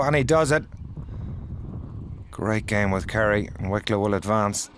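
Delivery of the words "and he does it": excited call. Real, not acted. Match-winning putt drops.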